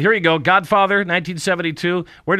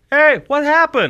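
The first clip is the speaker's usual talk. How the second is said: much higher and louder, slow puzzled "Hey, what happened?"; mock baffled viewer; 11 semitones higher, 5 dB louder, slower than usual. Host mimics a puzzled viewer, in a loud high baffled voice. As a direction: play a confused viewer, pitch jumping up.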